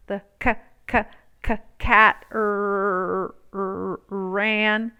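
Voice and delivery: stumbling, broken sounding out; halting baby reading. A narrator's reading. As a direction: stumble over each sound like a struggling child. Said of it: "reading like a baby".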